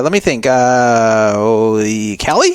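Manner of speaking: slow, drawn-out mock pondering; joking. Greeting the date he just met, slow, teasing. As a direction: stretch it out like a teasing guess. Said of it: "trying to give kinda, like, a humorous response".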